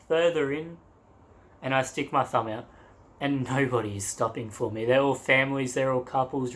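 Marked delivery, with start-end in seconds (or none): none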